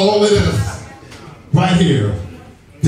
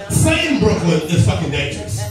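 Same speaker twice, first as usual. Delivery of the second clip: ordinary talk, nothing marked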